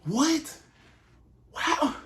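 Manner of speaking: very high squeaky gasp of surprise; jaw-drop disbelief, pitch shooting way up; servant's shock. Acted. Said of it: "And his servant like".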